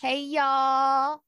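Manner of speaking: slow country drawl; country accent. Acted. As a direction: introduce yourself in a drawn-out country twang. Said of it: "natives here have a country accent".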